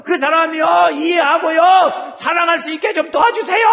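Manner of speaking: high, loud, pleading, slowing; crying out prayer. Shown as a demonstration. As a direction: plead with God for that person. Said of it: "하나님한테 얘기해요". (하나님께 간절히 부르짖기)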